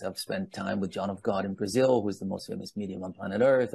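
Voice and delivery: ordinary talk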